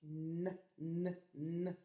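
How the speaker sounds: "n" répété, bref et doux; son N isolé. Shown as a demonstration. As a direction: faire entendre le son "n" détaché. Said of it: "Le son ne". (le son « n » répété séparément)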